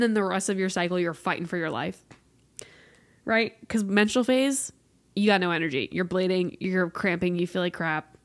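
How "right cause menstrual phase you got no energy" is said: ordinary talk, nothing marked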